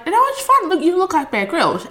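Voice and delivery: high, eager hype voice; hyped-up compliment. Retold or imitated. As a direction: gush it out like a fan. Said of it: "and I'm like".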